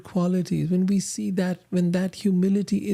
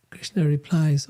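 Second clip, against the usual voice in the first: usual speaking voice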